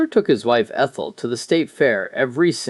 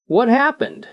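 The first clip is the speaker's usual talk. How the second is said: sharp, higher demanding question; 10 semitones higher than usual. Acted. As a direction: bark the question at him. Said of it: "the sergeant demanded".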